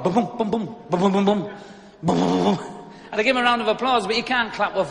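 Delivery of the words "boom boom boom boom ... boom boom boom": rhythmic low booms in bursts; mock banging noise, pulsing boom by boom; imitated headboard banging. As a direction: imitate a headboard thudding on the wall. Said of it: "I could hear the headboard".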